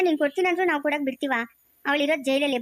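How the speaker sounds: usual speaking voice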